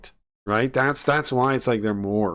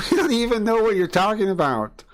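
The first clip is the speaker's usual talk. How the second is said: mock baffled capitalist; 8 semitones higher than usual. Same reply repeated, mocked higher.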